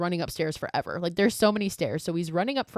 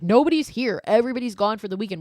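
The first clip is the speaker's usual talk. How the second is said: louder, higher, rushed outburst of frustration; raised, rapid complaint to campus security; exasperated yell; 4 semitones higher, 5 dB louder, faster than usual. Retold or imitated. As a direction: blurt it out fast in disbelief. Owen exasperated at useless security.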